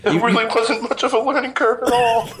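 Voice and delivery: high-pitched fake weeping through laughter; mock crying. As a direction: play up a tearful squeal, half laughing. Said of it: "Sounded like I started crying there".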